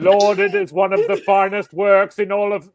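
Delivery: high, loud hammed-up proclamation; mock-grandiose. Co-host parodying the host's praise, mock-grandiose. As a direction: send up lofty praise at full volume. Carries on mocking lofty praise.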